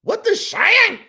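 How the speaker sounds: high, loud disbelieving question; baffled outburst. Real, not acted. Reactor stunned by the clip, loud high disbelief. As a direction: blurt it out in total confusion.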